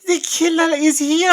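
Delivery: loud, high-pitched mock French accent; exaggerated French accent. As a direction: ham up a bad French accent, crying out. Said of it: "she's like, it's so French".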